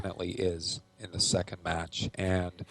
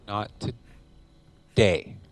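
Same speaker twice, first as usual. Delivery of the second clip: slow taunt; 4 dB louder, much slower than usual. Acted. Taunting the opponent.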